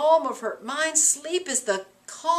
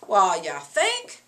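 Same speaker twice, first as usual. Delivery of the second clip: sarcastic; 4 semitones lower, 6 dB louder, slower than usual. Real, not acted. Teacher mocks Macbeth's complaint.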